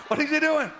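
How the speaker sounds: mock the bewildered mourners; laughing imitation of a baffled crowd; amused crowd imitation.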